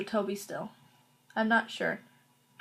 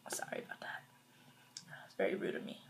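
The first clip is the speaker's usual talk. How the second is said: soft breathy apology after a slip; whispered apology; 16 dB quieter, faster than usual. Real, not acted. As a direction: murmur it, barely voiced. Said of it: "I'm trying to learn manners".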